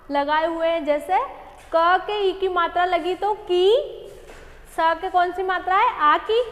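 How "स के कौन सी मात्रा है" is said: ordinary talk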